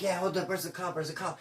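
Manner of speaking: nervous hushed voice. Retold or imitated. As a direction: whisper it nervously. Nervous whisper quote.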